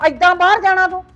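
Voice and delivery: angry scolding. Acted. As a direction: berate him loudly, mocking his plans. Mother berates son wanting abroad.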